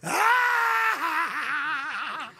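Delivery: squealing laugh and shout; high screaming laugh; high burst of laughter. Real, not acted. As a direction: explode into a shrill laugh.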